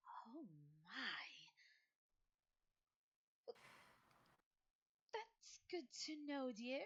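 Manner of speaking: put-on a gentle elderly headmistress; old lady. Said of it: "She blinks looking down at the child and goes".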